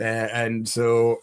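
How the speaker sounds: ordinary delivery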